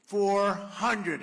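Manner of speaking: loud, steady machine callout of the altitude; loud computer callout. Retold or imitated. To the crowd, imitating the plane's loud altitude computer. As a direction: bark the number like an automated warning. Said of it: "a computer voice".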